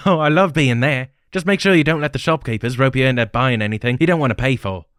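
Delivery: bubbly traveller warning about shopkeepers; Sahara's lively voice. Acted. Sahara gives travel advice.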